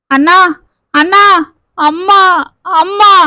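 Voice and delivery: a sister's broken cry for her brother; wailing. Acted. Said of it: "என்று மீண்டும் கதறினாள் கீதா". (கதறி அழுதபடி)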